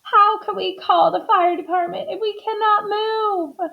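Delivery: high, plaintive protest; tearful. A narrator's reading. Missus Watson answering her husband, high and tearful. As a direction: wail it lightly, near tears. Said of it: "Missus Watson started to cry".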